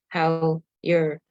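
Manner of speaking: ordinary talk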